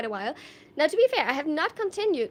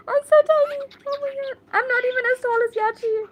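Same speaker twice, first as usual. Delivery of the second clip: very high, loud outburst of disbelief; dismayed squeal; 11 semitones higher, 8 dB louder than usual. Real, not acted. Streamer realizing she's shorter than Yachi, dismayed squeal. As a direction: squeal it out, much higher and louder.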